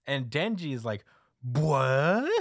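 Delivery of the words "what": loud, drawn-out rising "what"; hammed-up disbelief; baffled what. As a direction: blurt a stunned question, voice sliding up. Retelling Denji's baffled reaction.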